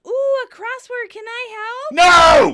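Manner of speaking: very high, loud, keen voice; eager offer. Retold or imitated. Phoebe spotting her friend's crossword, eagerly high. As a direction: squeal the offer with mock eagerness.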